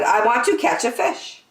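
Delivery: put-on high, eager child voice; impatient little girl. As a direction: pipe up like an impatient kid.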